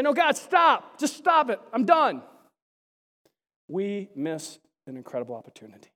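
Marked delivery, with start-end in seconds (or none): 0.0-2.2 high, exasperated outburst; fed-up protest to God; acted refusal. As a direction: plead loudly, then give up. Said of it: "if we say".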